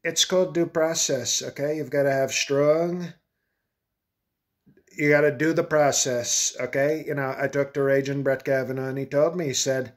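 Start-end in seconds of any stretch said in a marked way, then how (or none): none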